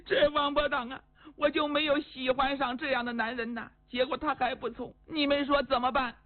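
high-pitched, whining sob-talk; tearful lament. Acted. Woman tells her maids of her unrequited love, crying. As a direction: complain through acted tears. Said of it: "您别哭了".

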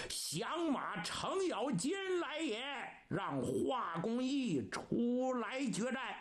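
high-pitched storyteller's war challenge; battle challenge. Acted. Rebel Cheng Yaojin challenging the pass commander, high challenge. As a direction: call it up high to the ramparts.

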